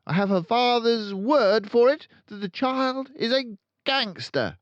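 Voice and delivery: loud, high barking headmistress; booming tyrant headmistress; barking Trunchbull. A narrator's reading. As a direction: bark it out like a bully. Trunchbull rants.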